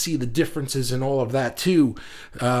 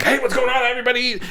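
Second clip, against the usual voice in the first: much higher, bright hyped greeting; show host voice; 10 semitones higher, faster than usual. Shown as a demonstration. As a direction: do your big livestream opener. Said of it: "I'm always gonna talk like this on Daily Fuse".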